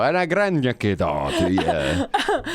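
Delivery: slow, drawn-out mock Welsh; mock Welsh accent. Shown as a demonstration. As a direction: ham up a Welsh greeting, slowly. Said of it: "That's Welsh".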